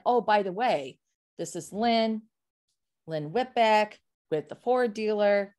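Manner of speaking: deliberate, unhurried name and dealership; slowed-down sign-off. Shown as a demonstration. Coach shows how to leave the name on a voicemail, slowly. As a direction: give the name slowly so it sticks. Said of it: "so you slow your cadence down".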